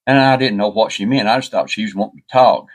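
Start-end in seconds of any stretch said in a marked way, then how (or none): none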